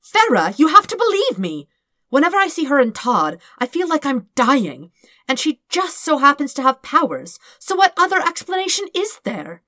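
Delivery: high, loud plea to be believed; desperate pleading. Acted. Dirk begging Farah to believe his theory, loud and high. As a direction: beg her to believe you.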